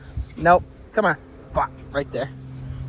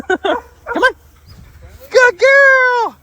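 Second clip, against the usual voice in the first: very high, squealed encouragement; excited dog praise; 6 dB louder, much slower than usual. Real, not acted. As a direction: cheer your dog on in a high voice. Calling and praising her dog.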